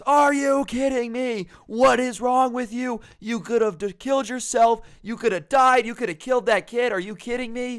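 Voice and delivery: an enraged dad tearing into his son; furious screaming. Retold or imitated. Dad yelling at his son behind the garage door, furious scream. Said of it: "he's just screaming at him so loud".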